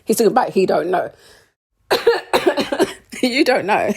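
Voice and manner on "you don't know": laughing. Real, not acted. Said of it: "That made me laugh".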